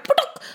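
high, popping "putuk"; quick cartoon thwack made by voice; imitated impact noise. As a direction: pop it out high. Imitates tooth hitting the moon.

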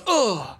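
quick mock exertion noise; Obi-Wan. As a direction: mimic a fighter's effort grunt. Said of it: "Obi-Wan, like, goes".

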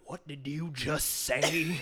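slow, drawn-out disbelief with a laugh; mock shocked. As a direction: stretch it out in fake shock. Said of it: "Tom Hanks is like".

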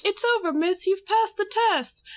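high-pitched imitated child; little boy. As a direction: put on a small boy's high voice. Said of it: "this little boy shows up".

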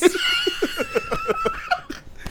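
laughing hard in a high squeal. Real, not acted. Big laugh.

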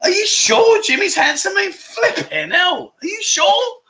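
high, squeaky "really?" of playful disbelief; teasing the girl who called Jimmy handsome, high mock disbelief. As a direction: pretend you can't believe the girl means it. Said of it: "I'll be like".

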